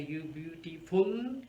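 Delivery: beautiful with the stress wrongly on the drawn-out last syllable. Shown as a demonstration. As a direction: stretch out beautiful slowly, landing on -FUL. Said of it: "not gonna sound very good or natural".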